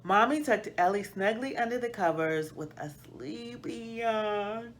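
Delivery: ordinary delivery, nothing marked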